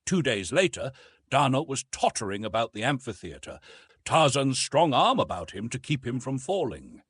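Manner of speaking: ordinary delivery, nothing marked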